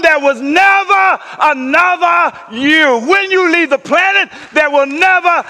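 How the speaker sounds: preaching at full force. Acted. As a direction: build the room to a roar.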